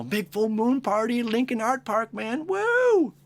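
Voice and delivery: high, hyped party whoop rising to a yell; party-goer. Retold or imitated. Party friend hyping the moon party, high excited whoop. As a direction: whoop it up like a partier. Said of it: "you know".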